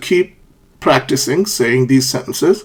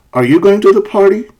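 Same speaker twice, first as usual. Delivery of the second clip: higher voice, lifting "party" at the end; rising question; 6 semitones higher, 4 dB louder than usual. Shown as a demonstration. Teacher models a yes-no question, rising intonation. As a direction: pitch climbs steadily up to "party". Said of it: "So the pitch is rising".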